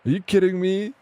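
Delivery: acting out his stunned reply to the friend; incredulous outburst. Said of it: "I'm like".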